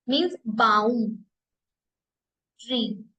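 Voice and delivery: usual speaking voice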